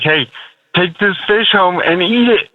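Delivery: ordinary delivery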